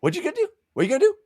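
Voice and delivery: high-pitched mock taunting; Whiskey Jack. Defender daring her to act, mock taunting. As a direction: goad them twice in a silly high voice.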